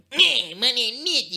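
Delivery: high, slow put-on karate dude voice; drawn-out silly karate voice; funny karate guy. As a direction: ham up a goofy martial-arts guy. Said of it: "this really funny karate character".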